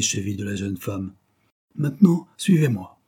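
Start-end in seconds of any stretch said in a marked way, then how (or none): 1.8-3.0 loud, raised-pitch order; commanding. A narrator's reading. Judge ordering the freed suspect along, commanding. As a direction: order it firmly. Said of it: "ordonna-t-il en se relevant".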